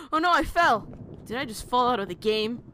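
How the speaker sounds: loud, high yelp of dismay; loud disbelief after a gasp; startled outcry. Real, not acted. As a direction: cry out in alarm at a sudden fall. Falls out of the game map.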